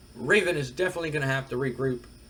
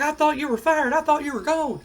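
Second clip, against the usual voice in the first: much higher, loud shocked outburst; 11 semitones higher, 4 dB louder than usual. Retold or imitated. Manager shocked to see the returning wrestler, high disbelief. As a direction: squeal it out in disbelief. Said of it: "Jim Cornette is shocked. He, he was like".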